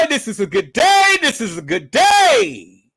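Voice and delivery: loud crowing, last word stretched; gleeful celebration. Real, not acted. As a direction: crow it out with delight.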